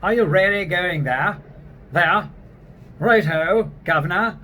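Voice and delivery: hammed-up snooty British accent; haughty British demo voice; snotty British. Shown as a demonstration. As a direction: play a snobbish Brit. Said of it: "if you want to be snotty".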